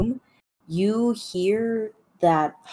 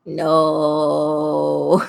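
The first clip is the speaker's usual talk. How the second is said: long drawn-out mock wail; plaintive wail; 3 semitones lower, 5 dB louder than usual. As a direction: wail it as a shut-in cat. Cat shut behind a door.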